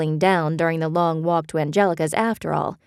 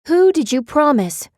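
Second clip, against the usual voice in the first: pressing demand; 10 semitones higher, 5 dB louder than usual. A narrator's reading. Beth pressing hard.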